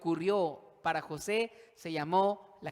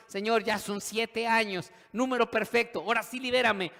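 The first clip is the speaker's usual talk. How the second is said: loud, raised-pitch demand for release; exasperated plea; 6 semitones higher, 7 dB louder than usual. Acted. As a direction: cry out to God in mock desperation. Acted plea after seven years.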